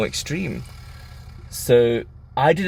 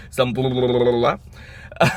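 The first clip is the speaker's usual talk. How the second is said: ordinary talk, nothing marked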